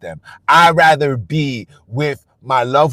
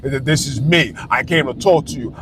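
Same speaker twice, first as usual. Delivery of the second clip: lower, hushed divine voice; voice of God; 4 semitones lower, 4 dB quieter than usual. Retold or imitated. Vlogger imitates God finally visiting him, low and hushed. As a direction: speak like a deity revealing itself. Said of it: "he said".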